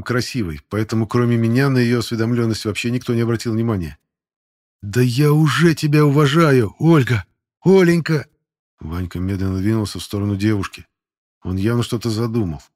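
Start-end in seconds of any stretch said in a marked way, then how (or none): 4.8-8.3 loud, slow, higher drawn-out flirting; brash flirty call. Acted. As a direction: call her name out cheekily. Cadet calls out to teacher.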